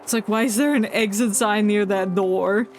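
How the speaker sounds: ordinary delivery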